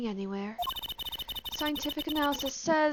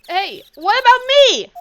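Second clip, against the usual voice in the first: much higher, louder hey; indignant shout; 11 semitones higher, 13 dB louder than usual. Acted. Sidekick protests being ignored, loud and high. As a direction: demand to be noticed. Character demands attention.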